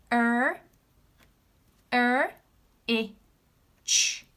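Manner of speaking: r i ch said as separate sounds; sounding out. Shown as a demonstration. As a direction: sound out r i ch slowly. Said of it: "Let's read the word together".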